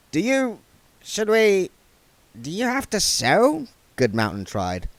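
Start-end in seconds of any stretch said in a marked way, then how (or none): 0.1-3.7 higher, halting put-on dwarf voice; dwarf unsure how to help a vampire; flustered dwarf. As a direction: stumble over the question. Goodmountain flustered.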